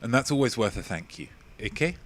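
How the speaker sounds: ordinary talk, nothing marked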